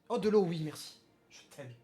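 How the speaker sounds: away from the microphone; talking off to the side; far from the mic. Real, not acted. Off-mic exchange with wife. (loin du micro, hors champ)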